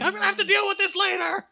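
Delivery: high, strained stifled scream; your trapped sober self squealing from far inside; tiny high-pitched inner scream, held on one note; silently screaming sober self. Acted. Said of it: "like, screaming silently".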